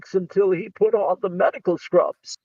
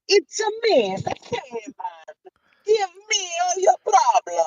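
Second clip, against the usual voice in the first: high-pitched, badly faked Bulgarian accent; cartoonish mock-Bulgarian, pitched way up; T-Man's bad Bulgarian accent; 9 semitones higher than usual. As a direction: do a silly, hammed-up attempt at a Bulgarian accent. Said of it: "I don't know how to do a Bulgarian accent".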